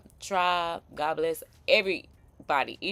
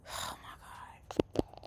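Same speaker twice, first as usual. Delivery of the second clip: whisper; 10 dB quieter, much slower than usual. Real, not acted. Stunned reaction to video.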